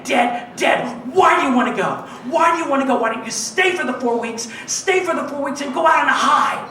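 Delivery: high-pitched insistent badgering; imitating a colleague's worked-up protest; urgent pleading. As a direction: pepper them with exasperated questions. Castmate urging her colleague to stay.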